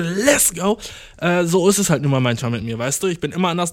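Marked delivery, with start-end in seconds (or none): 0.0-0.8 hyped. Real, not acted. Hyped catchphrase.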